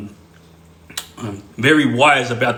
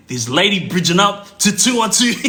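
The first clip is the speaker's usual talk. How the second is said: higher, amused words breaking into laughter; laughing; 4 semitones higher than usual. Real, not acted. Storyteller amused by old lady challenging brother, real laughing. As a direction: crack up as you tell it.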